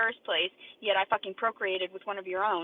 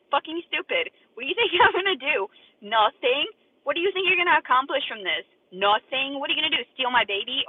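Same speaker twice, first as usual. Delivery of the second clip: loud, high, furious taunting; furious yelling; 6 semitones higher, 7 dB louder than usual. Real, not acted. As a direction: shout the taunts at them in real rage. Furious rant at critics.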